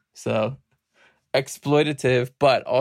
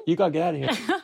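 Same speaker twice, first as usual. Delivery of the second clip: laughing; 5 semitones higher, much faster than usual. Real, not acted. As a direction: hurry them out, laughing. Child walks into room.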